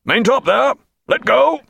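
very high hail aloft; cried out. A narrator's reading. Captain hailing the maintop, high cry. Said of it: "he cried".

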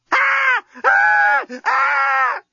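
piercing terrified shrieks; high screams. A narrator's reading. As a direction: scream for your life. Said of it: "Lucy had never screamed so well".

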